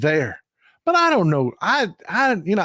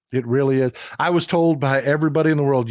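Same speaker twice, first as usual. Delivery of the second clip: usual speaking voice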